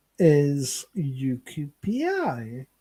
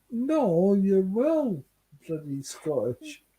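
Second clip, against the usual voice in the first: ordinary talk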